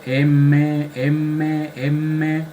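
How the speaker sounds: M repeated, each one held on a long nasal hum; resonant M. Shown as a demonstration. Teacher testing for resonance in the maxillary sinus, M hummed long. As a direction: draw out each M until it buzzes in the sinuses. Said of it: "Siento acá una resonancia yo a nivel acá".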